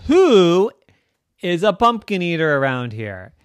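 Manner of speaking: loud, high 'who', then coming down; accusing demand. Acted. Rose questioning her family, accusing. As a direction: demand to know the culprit.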